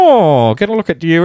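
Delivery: imitating a co-worker's high, broad Kath and Kim voice; Kath and Kim accent. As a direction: sing it out like a Fountain Lakes mum. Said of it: "we're doing Kath and Kim accents".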